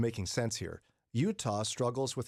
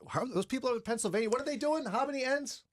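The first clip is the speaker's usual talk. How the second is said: much higher and fast, baffled; high-pitched confused voice; 11 semitones higher, faster than usual. Retold or imitated. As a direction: squeak it out in mock confusion. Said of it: "They're like".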